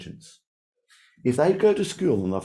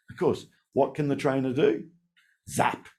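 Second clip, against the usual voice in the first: ordinary talk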